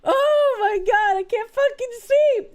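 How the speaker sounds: high, slow, tearful laughing; crying with laughter. Real, not acted. Laughing so hard at chat she can't see. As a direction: gasp it out while laughing to tears. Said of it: "I'm crying".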